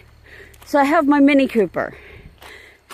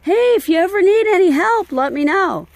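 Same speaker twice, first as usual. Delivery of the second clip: high, bright mocking of empty promises; mock friendly offer; 8 semitones higher than usual. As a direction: parrot the friends' sing-song offer sarcastically. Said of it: "all these people that always say".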